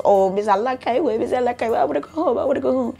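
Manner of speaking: whiny mocking imitation of the aunt; mock crying complaint. Vlogger mimicking the aunt begging to go home, mock crying. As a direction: put on a plaintive, climbing mock wail. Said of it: "bitching, crying, and complaining".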